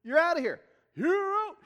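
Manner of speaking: loud, high umpire call. Retold or imitated. Preacher casting out demons like an umpire, hollering. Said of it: "It's like an umpire".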